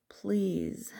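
whispered begging, voice cracking a little; desperate pleading. Acted. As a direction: beg in a breaking whisper. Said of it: "I beg, desperation seeping into my words".

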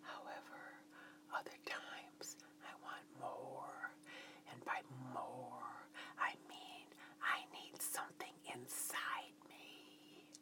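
hushed, breath-only confession; whispered voice. A narrator's reading. Woman continues confiding in her lover, whispered. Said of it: "and continued".

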